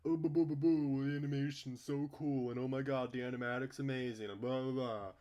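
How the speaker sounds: high, loud mocking singsong; loud derisive imitation; mocking parody. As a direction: sneer at the song's fans. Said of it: "do the fucking shitty song".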